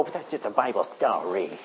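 low, soft dismissive scoff; hint of a mocking sceptic; mocking scoffer. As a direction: brush off the Bible with a sneer. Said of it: "mock all you like and go".

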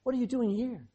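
the right, gentle version of God's question. Pretend. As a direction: ask it kindly, without blame. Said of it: "He said".

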